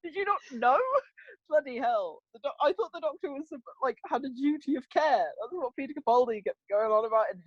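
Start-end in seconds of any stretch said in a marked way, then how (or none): 0.0-2.2 exasperated disbelief. Retold or imitated. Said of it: "I'm like".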